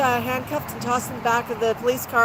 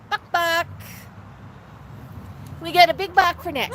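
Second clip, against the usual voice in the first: high, sing-song coaxing voice for a parrot; pet talk; 5 semitones higher than usual. Acted. Host cooing at her parrot Max on livestream, high sing-song. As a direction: coo and coax the bird like a toddler.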